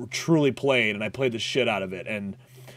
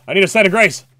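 louder, higher desperate repeat; frantic self-talk; more urgent; 8 semitones higher, 11 dB louder, faster than usual. Real, not acted. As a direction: plead it again with rising urgency.

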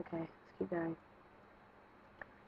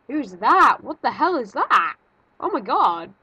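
loud, higher, startled questioning; alarmed shout; 4 semitones higher, 11 dB louder than usual. Real, not acted. Player spots something unknown in game, alarmed shout. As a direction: demand to know what just appeared.

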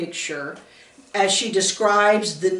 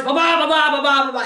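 higher-pitched putt-putt of a revving motorbike; playful mouth-made motorcycle rumble; motorcycle engine imitation; 5 semitones higher, faster than usual. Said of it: "he'd crank it up in the garage".

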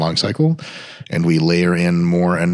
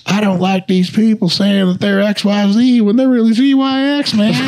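high, loud sneering mimicry; mocking high whiny voice; 4 dB louder than usual. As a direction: whine it like a know-it-all. Mocking people who mislabel things.

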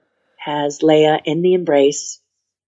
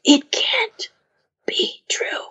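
hushed, breathless whisper; husky low whisper; 8 semitones higher, 3 dB quieter, slower than usual. Retold or imitated. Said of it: "saying in a husky low voice".

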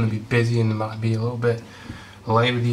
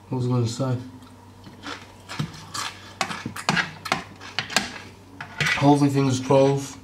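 talking with a mouthful; mouth full; 4 dB quieter, faster than usual. Real, not acted. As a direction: speak through a bite of food. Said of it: "that's bad manners talking with your mouth full".

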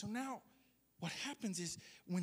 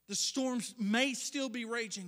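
slow loud declaration; 3 semitones higher, 7 dB louder, slower than usual. Retold or imitated. Climax of voiced prayer.

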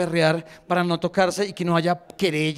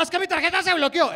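high, fast irate complaint; hammed-up furious customer rant; pissed-off caller; 11 semitones higher, faster than usual. Customer phones Bancolombia about a blocked card, pissed off. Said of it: "usted llama emputado".

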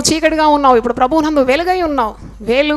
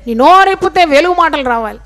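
usual speaking voice

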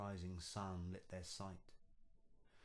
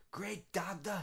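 high, loud cry of shock; frightened cry; 12 semitones higher, 8 dB louder than usual. Acted. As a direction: recoil in fright with a yelp. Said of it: "he cried".

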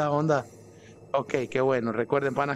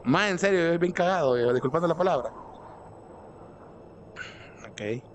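ordinary delivery

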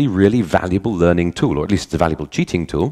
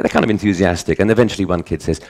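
normal talking voice

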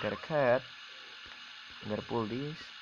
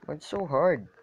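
usual speaking voice

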